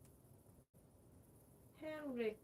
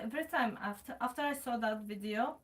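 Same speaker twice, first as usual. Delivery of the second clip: ordinary delivery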